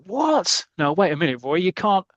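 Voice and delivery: high-pitched disbelieving "what", then protesting; acted shocked protest. Mervyn objecting to Roy taking him off, acted shock. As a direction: act taken aback and object.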